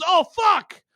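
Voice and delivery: high, loud startled cry; panicked yelp. Acted. Explorer hearing the curse, a high panicked yelp. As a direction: squeal in sudden terror.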